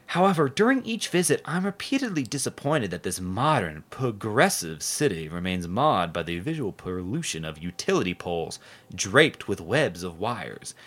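slow, mock-earnest reading of a reader's letter. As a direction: read it out like a pompous op-ed. Reading a letter to the editor aloud.

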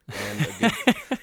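comic squeaky high pitch; high squeak for a laugh. Acted. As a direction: squeak it out jokingly. Joking pause, squeaky rising word.

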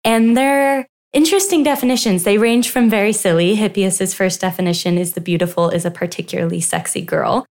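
ordinary talk, nothing marked

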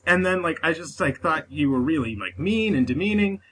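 ordinary talk

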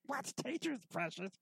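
high raspy mock Gollum; imitating Gollum. Teasing his wife with a high Gollum squeak. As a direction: squeak it like Gollum hissing over food. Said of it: "I was like".

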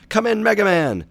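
very high, loud radio call; frantic. A narrator's reading. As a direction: yell desperately for a reply. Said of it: "Doctor Light sounded frantic".

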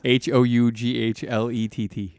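letter by letter, spelled; spelling it out. Shown as a demonstration. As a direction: spell each letter clearly. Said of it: "How do you spell that?".

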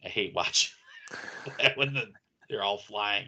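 ordinary talk

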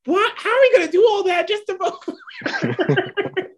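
high-pitched, giggling disbelief; laughing incredulity. Retold or imitated. Podcaster retelling what they wrote down, laughing incredulity. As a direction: squeal the question, dissolving into laughter.

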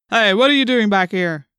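loud, challenging demand; demanding policeman. A narrator's reading. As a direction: challenge an intruder loudly. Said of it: "the policeman demanded".

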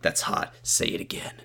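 breathy creepy whisper; sleazy pickup line. Retold or imitated. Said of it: "And then he goes".

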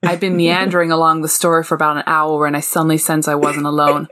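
low, measured American narration; American accent. Acted. Reading a vampire novel excerpt to co-hosts, American accent. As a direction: read it in your best put-on American. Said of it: "pull in my best American accent".

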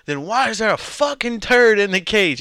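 high, loud mock outrage; retold yelling. Boss confronts worker over dirty cage, mock outraged yell. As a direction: demand an answer in a mock yell. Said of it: "I started yelling at him".

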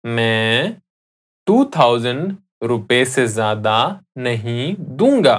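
slow teaching demo; slowly. Shown as a demonstration. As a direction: piece by piece, pausing between chunks. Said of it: "I'll say that again slowly".

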